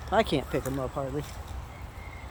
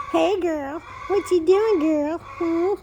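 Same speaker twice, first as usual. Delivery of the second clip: pet talk; 12 semitones higher, 4 dB louder, much slower than usual. Real, not acted. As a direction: coo sweetly at the puppy. Cooing at the dog Penny.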